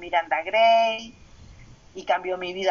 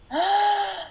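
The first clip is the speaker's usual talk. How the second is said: high gasp of realization; a sharp high "ah" as it hits her; sudden realization; 11 semitones higher than usual. Real, not acted. Recalling the moment her daughter's words hit her, high surprised gasp.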